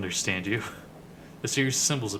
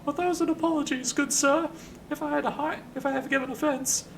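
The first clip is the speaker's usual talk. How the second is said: polite apology in a high falsetto. Acted. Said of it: "in a high voice".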